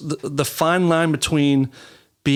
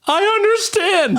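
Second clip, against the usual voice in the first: put-on higher, slower small kid voice; scared little kid; 4 dB louder, slower than usual. Boy repeating it for the big brother, high timid voice. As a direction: squeak it out even more timidly.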